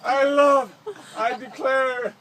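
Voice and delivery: put-on swooning girl. Girls' swooning reply voiced.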